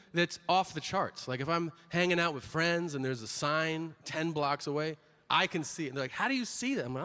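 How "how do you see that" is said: high-pitched incredulous question; hammed-up, high disbelief; mock amazement. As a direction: imitate an astounded friend. Imitates amazed friends.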